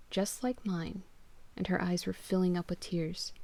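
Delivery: ordinary delivery, nothing marked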